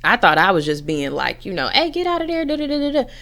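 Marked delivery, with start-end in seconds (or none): 1.7-3.1 mock shout at pets caught in the chips; scolding yell at the cats. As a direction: snap at the cats to get out, pitched way up. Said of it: "the yelling and the aggression".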